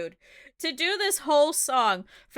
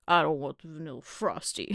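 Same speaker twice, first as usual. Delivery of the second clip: imitating dad; 10 semitones lower, 5 dB quieter than usual. Imitating dad refusing the Frosty. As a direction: lower mock dad voice. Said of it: "He's like".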